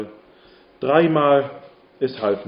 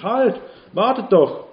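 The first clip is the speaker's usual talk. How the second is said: higher, louder, slow shout after the wagon; shouted call; 8 semitones higher, 4 dB louder, slower than usual. A narrator's reading. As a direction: yell to stop the wagon. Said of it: "schrie, so laut er nur konnte". (laut hinterherrufen)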